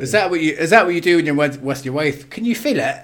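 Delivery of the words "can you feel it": high teasing voice, laughing; mock seductive question. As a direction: tease him in a high mock voice. Mocking innuendo about wife.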